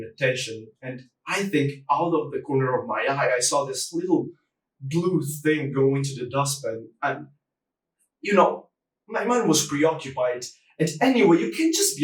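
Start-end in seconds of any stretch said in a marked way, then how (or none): none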